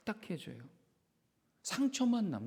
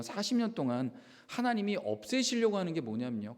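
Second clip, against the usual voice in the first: ordinary delivery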